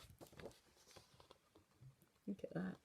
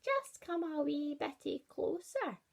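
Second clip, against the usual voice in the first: wolf coaxing; 8 semitones higher than usual. Acted. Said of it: "slavered the wolf".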